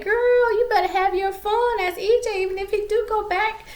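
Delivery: put-on high-pitched girl-talk voice; exaggerated girlfriend voice, way up high; sassy girlfriend. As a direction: imitate a friend egging someone on. Acts out girlfriend egging her on.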